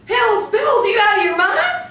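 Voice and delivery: high, loud, fast, half-laughing alarm; shrieking at her friend in disbelief; laughing panicked shout. Acted. Joan panicking at gunfight.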